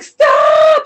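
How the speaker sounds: very high, loud shouted stop; inner voice shouting stop. Retold or imitated. Inner voice stopping her scroll, a piercing shouted stop. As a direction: yell stop like a sudden command. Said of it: "She's like".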